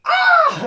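very high, loud mock scream; agonised scream. Villain eaten by nanobots, mock scream. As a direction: shriek in hammed-up agony.

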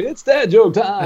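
slow, high showman call; announcer voice. Acted. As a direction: announce the segment big. Announcing dad joke segment.

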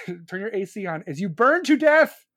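normal talking voice

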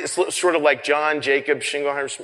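ordinary delivery

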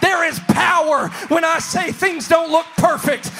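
fiery sermon climax; preaching at full force. Acted. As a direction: preach it out to the congregation.

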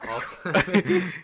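slow, drawn-out mock announcement; mock solemn. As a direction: stretch it out like a slogan.

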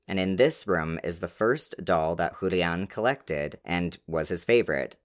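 ordinary delivery

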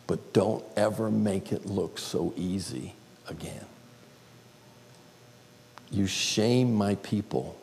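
slow, soft, tender divine voice; God's gentle correction, softly spoken. Retold or imitated. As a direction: speak slowly and gently, like a loving father. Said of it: "very gently, I heard this little voice".

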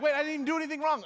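high, loud, rushed protest of innocence; panicked spouse protesting. Retold or imitated. Spouse pictured by a jealous partner, protesting high, loud and rushed. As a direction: blurt it out like a spouse caught off guard. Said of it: "And they're like".

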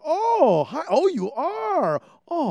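high, loud, drawn-out auntie; big high-pitched auntie welcome; delighted auntie. Retold or imitated. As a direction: gush it slowly in a high auntie voice. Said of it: "And she's like".

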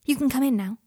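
a cheerful invitation inside; happy. A narrator's reading. Friend calls waiting man into the room, happy. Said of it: "she said happily".

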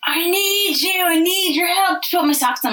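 put-on high, slow pleading child's whine; mock whiny child; whiny kid voice. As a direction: imitate the kid begging for help. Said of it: "screaming in those ninety seconds".

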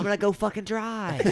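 high, whiny mock complaint to mom; a kid whining that mom took his aloe; whiny teen.

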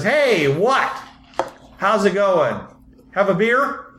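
high, hearty welcome in character; Moby. Acted. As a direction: greet a visitor with hammed-up friendliness. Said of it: "Moby looks at you and says".

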